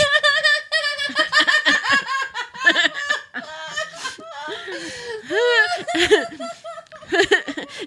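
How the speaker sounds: long, high, tearful laughing fit; helpless laughter. Real, not acted. Host cracked up by own joke, helpless laughter. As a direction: crack up until nearly crying.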